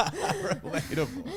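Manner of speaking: high, slow, laughed-out word; laughing. Real, not acted. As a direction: say it through real laughter. Repeats listener's word while cracking up.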